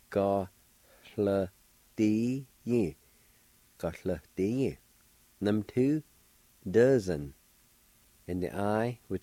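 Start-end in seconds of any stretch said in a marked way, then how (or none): 0.1-3.0 Ga, hla, di, yin in separate beats, faint H before the L; Ga, hla, di, yin broken up, aspirated hla; syllable by syllable. Shown as a demonstration. Teacher repeating the word for learners, syllable by syllable. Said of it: "there's a faint H or an aspirated sound".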